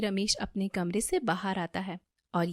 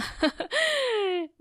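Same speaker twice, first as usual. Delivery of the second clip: high gleeful schemer's laugh; 13 semitones higher than usual. A narrator's reading. Damini plotting to steal the money, gleeful laugh. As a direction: laugh high over a sly plan.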